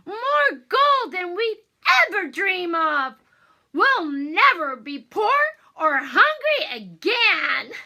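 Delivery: loud, high delight; overjoyed. Acted. Hansel promising his father they'll never be poor, loud joy. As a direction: rejoice over the treasure. Hansel rejoicing over treasure.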